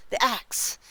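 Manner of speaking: mounting ghostly cries, then easing; horrified recollection. A narrator's reading. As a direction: cry it out in repeated bursts of horror. Ghost recalls the axe in horror.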